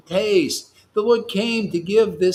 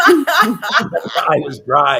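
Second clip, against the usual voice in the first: words breaking out of a laugh, louder; 9 dB louder, much slower than usual. Real, not acted. Teacher telling of one tear from one eye, laughing. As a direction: laugh through the punchline, slowly. Amused by his own story.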